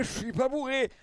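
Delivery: high denial, falling at the end; trying to sound sober. Retold or imitated. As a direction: repeat the protest, settling down. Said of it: "quand t'es là en mode".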